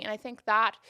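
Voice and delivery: ordinary talk, nothing marked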